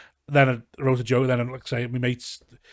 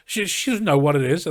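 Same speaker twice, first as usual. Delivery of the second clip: high, louder careless cousin voice; mock cousin; 5 semitones higher, 6 dB louder than usual. Cousin brushing off the grandson's complaint, loud and careless. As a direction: shrug it off loudly as the cousin.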